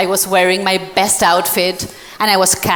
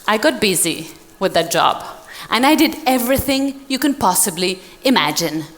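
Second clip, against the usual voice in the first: ordinary talk, nothing marked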